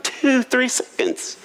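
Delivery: slow counting. Retold or imitated. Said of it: "with not an ounce of sarcasm".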